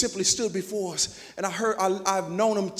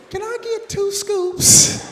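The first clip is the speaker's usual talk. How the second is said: put-on high, slow little-kid voice; kid asking; 10 semitones higher, 7 dB louder, much slower than usual. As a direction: ask sweetly like a chubby kid. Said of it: "I go up to her and I say".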